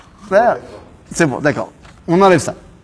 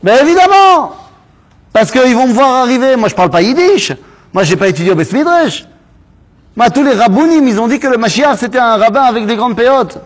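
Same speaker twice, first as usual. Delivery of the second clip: much higher, exasperated Moshe; a worried Moshe giving his reasons; Moshe protesting; 7 semitones higher, 3 dB louder than usual. Acted. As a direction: play Moshe pleading that nobody will believe him. Teacher voices Moshe's worried protest.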